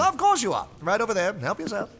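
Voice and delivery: bright, high and loud, full of delight; a sudden burst of high cheer; cheerful eccentric. Acted.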